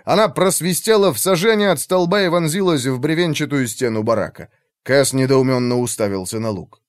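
ordinary delivery

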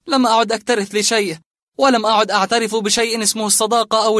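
loud, high, defiant tirade; bitter outburst. Acted. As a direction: rage against the whole world.

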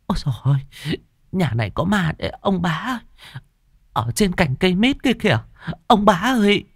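high, panicked wail; frightened crying out. A narrator's reading. Mistress telling husband of the ghost, wailing. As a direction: wail for help in fear. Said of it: "Bà Hai kêu lên".

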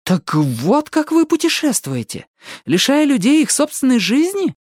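high, appalled realization; shocked. A narrator's reading. Ali learns how they travel, openly shocked. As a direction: let the shock show, voice climbing high. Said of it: "Али была потрясена и не скрывала этого".

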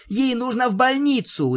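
frantic. Acted. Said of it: "заметалась Твайлайт".

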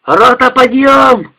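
high, loud, slow wake-up bellow; half-asleep bellow. Acted. Sleepy soldier bellows the company awake. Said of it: "спросонья заорал Бабай".

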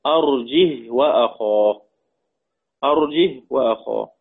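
"أرجه وأخاه" with a vowelless h closing "arjih"; silent-vowel ha, no hamza. Shown as a demonstration. Teacher showing Asim and Hamza's reading, "أرجه وأخاه" as arjih wa-akhah. سكون الهاء بدون همز.

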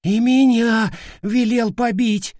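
slow, high squeaky complaint; squeak. A narrator's reading. Cautious fighter seizes his moment to complain, squeaking. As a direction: pipe up in a little squeak. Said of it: "пискнул осторожный боец".

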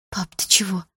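frightened hushed whisper. Acted. Daughter whispering as her father fades away, hushed. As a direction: whisper as he vanishes.

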